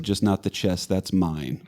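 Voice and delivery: low, soft retelling of a partner's words; softly retold quote. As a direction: quote your partner gently, voice dropping low. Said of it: "she didn't use that tone".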